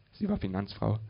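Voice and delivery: plain reading voice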